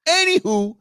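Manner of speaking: high, loud, lilting anywho; sing-song anywho. Real, not acted. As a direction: chirp it to change topic. Sing-song topic switch.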